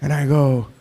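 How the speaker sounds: usual speaking voice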